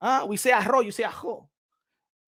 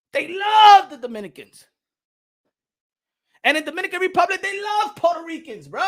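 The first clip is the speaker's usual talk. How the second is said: excited shout; 7 semitones higher, 5 dB louder than usual. Real, not acted. Fired-up claim of brotherhood.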